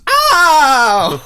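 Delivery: high, loud laughing "Ow!"; hammed-up cry of pain; mock pained yelp. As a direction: yelp like you've been shot, laughing. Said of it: "the dad goes".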